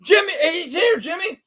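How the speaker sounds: high, urgent mock shout to the coach; excited call. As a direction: call out high like you just spotted him. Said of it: "And Troy goes".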